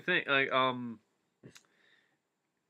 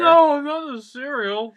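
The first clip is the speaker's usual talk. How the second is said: high, loud, slow mock wail; hammed-up cry for the lost cereal; theatrical despair over cereal; mock anguish; 14 semitones higher, 10 dB louder, slower than usual. Mock grief over spilled cereal.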